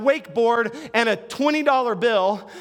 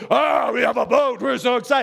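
excited. Retold or imitated. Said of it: "Matt and I are like".

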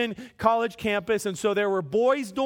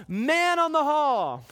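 drawn-out, higher-pitched hallway call; slow, raised-pitch call-out; yelled announcement; 4 semitones higher than usual. Retold or imitated. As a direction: call out a long warning down the hall. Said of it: "I had to yell out".

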